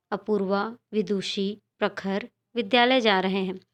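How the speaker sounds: "Apoorva", pause, "विदुषी", pause, "प्रखर" and on; short pauses between names. Shown as a demonstration. Teacher reads a comma example to children, pausing between the names. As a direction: leave a little gap after "Apoorva" and after "Vidushi". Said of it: "नामों के बीच में थोड़ी-थोड़ी".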